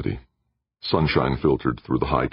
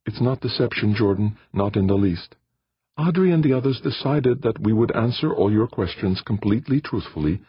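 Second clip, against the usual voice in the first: higher, lighter female voice for Aditi; Aditi's voice; 8 semitones higher than usual. A narrator's reading. Aditi answers Jordan in her higher voice.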